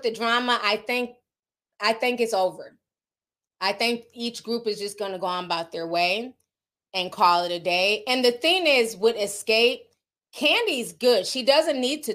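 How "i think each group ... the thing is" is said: ordinary talk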